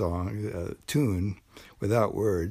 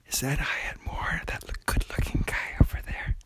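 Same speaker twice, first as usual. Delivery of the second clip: gossipy hushed whisper; 5 semitones higher than usual. Pretend.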